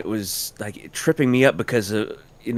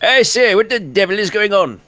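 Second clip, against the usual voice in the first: high, loud posh British officer voice; old British officer; 9 semitones higher, 4 dB louder than usual. Retold or imitated. Quoting Peppy's line as an old general. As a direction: play it like an old war pilot. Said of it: "World War One pilot or general".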